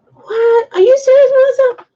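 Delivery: high, loud outburst of disbelief; shocked disbelief. Real, not acted. Reading a viewer's comment, shocked and loud. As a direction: jump up in pitch, can't believe it.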